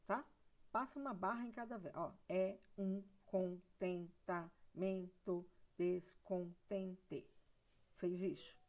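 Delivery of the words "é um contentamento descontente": very slow, even syllable-by-syllable reading; sílaba por sílaba. Shown as a demonstration. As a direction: break every word into separate, evenly timed syllables. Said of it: "você divide todas as sílabas". (escandindo devagar, sílaba por sílaba)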